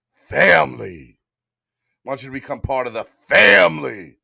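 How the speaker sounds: big action-star voice; Vin Diesel. Acted. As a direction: stretch it out like an action hero. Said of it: "In my Vin Diesel voice".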